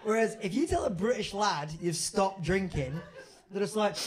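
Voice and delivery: normal talking voice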